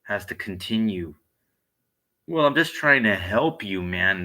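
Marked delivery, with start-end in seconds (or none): 2.3-4.2 loud mock of the guy. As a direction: throw his words back loudly. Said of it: "And then he goes".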